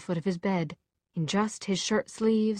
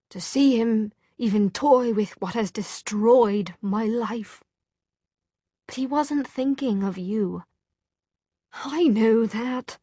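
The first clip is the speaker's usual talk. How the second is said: higher, louder, wounded protest; bitter protest; 4 semitones higher, 4 dB louder, slower than usual. A narrator's reading. Jem tells Tessa of Will's betrayal, bitter protest. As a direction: press hard on the words, pitch raised. Jem's bitter outburst about Will.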